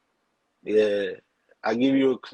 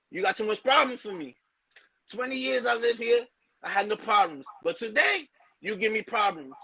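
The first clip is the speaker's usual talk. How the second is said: much higher, put-on accent of a Spanish bodega owner; store owner accent; 9 semitones higher than usual. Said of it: "He said".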